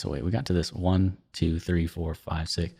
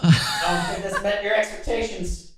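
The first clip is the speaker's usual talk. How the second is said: laughing; 11 semitones higher than usual. Real, not acted. Laughing after failed noise.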